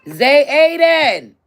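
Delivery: loud drawn-out call across the house. Real, not acted. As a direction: yell a name to another room. Calling out to child.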